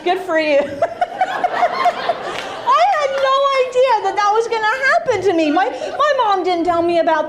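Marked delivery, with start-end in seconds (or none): 0.7-2.9 high-pitched cackle; real laughter, pitched up; high burst of laughter. Real, not acted. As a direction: break into a big high laugh. Big laugh at own story.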